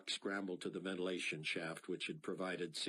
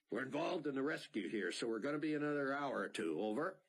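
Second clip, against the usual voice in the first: brisk higher radio report; radio call; 7 semitones higher, 3 dB louder, faster than usual. A narrator's reading. Pilot radioing the shelter operator, brisk. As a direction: report fast, like over the radio.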